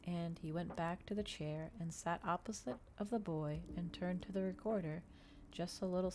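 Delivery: ordinary delivery, nothing marked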